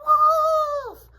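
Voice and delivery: very high, loud, drawn-out cry; storybook shepherd boy screaming for help; yelled alarm. A narrator's reading. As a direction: yell wolf with all your might. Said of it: "immediately yelled".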